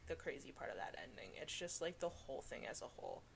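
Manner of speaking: dropped to a hushed whisper; soft, breathy hush over the ending. Real, not acted. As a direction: whisper it like a spoiler secret. Hushed about the twist ending.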